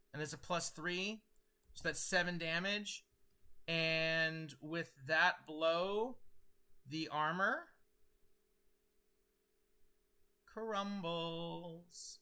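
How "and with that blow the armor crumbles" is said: slow, dramatic game master narration. A narrator's reading. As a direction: let the blow land slowly.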